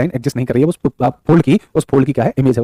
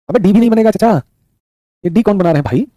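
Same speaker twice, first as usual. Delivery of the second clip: high-pitched teacherly disbelief; playful exasperated outburst; exasperated teasing scolding; 7 semitones higher, 3 dB louder than usual. Real, not acted. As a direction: chide them, pitch jumping up in protest. Teacher mock-scolds students' wrong answer.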